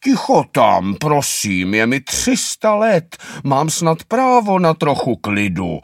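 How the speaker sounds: put-on a three-hundred-year-old turtle yelling through the wall; old turtle shouting. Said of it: "bouchá a volá".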